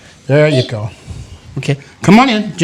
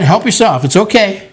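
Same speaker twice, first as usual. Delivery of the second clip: ordinary talk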